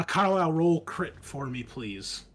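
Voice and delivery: ordinary talk, nothing marked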